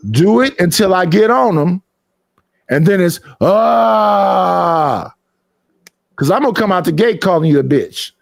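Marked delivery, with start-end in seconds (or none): 3.4-5.1 long drawn-out "aah"; open, held mock yell; mimicked roar. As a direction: imitate a sustained outburst cry. Imitates the outburst when he attacks.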